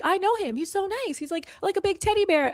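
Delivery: high, sweet starry-eyed fan voice; gushing friend. Retold or imitated. Friend insisting he's a teddy bear, high and sweet. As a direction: gush about him like a smitten fan.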